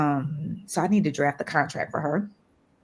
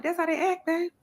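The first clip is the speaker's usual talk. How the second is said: husband explaining; 13 semitones higher than usual. Retold or imitated. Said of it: "He was like".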